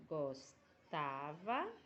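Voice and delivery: slow demo pronunciation. Shown as a demonstration. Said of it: "eu vou seguir o exemplo daqui".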